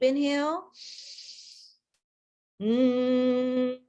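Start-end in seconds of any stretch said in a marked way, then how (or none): 2.6-3.8 lips closed steady humming; steady hum. Shown as a demonstration. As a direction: hum on one note. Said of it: "exhale to a humming sound".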